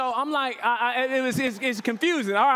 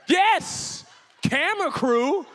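bluffing confidence; 5 semitones higher, 4 dB louder, much slower than usual. Retold or imitated.

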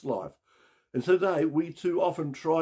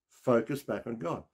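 usual speaking voice

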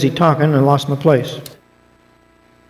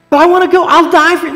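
put-on much higher, pleading young voice; eager Peter; 12 semitones higher, 4 dB louder, faster than usual. Peter pleading with Jesus to follow him, high and eager. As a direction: beg to come along, earnestly.

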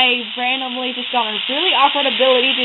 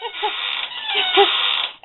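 high laugh; 4 dB quieter than usual. Real, not acted. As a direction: burst of high giggling. Reacting to the villain fight.

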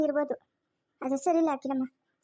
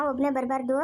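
usual speaking voice

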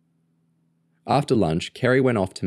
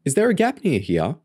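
high, eager little sister's question; 8 semitones higher than usual. A narrator's reading. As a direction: ask it keenly, pitched up. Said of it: "Lauren asked eagerly".

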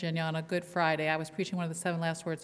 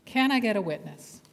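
louder preaching call; preached call; 3 semitones higher, 4 dB louder than usual. Shown as a demonstration. Preacher calling on her quiet listeners, louder. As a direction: call for a witness with force. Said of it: "you're all very quiet this morning".

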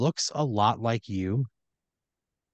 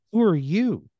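high, louder startled question; puzzled challenge; 9 semitones higher, 6 dB louder, slower than usual. Acted. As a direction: demand it of an unexpected stranger, pitch up. Startled stranger questions the house's occupant.

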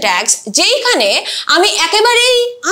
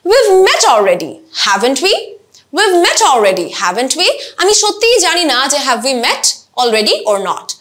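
statement falls, tag rises; teacher drills uncertain tags rising at the end. Shown as a demonstration. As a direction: sound unsure, lift the tag. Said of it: "I am actually uncertain".

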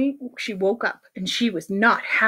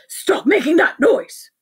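higher, forceful scolding; stern principal; 6 semitones higher, 4 dB louder than usual. Acted. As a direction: order them to stop, punching each word.

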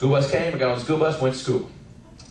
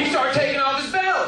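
ordinary talk, nothing marked

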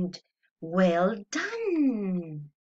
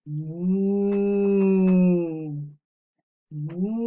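drawn-out moo like a cow; low mooing cow; 5 semitones lower, 5 dB louder than usual. Shown as a demonstration. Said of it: "be a cow".

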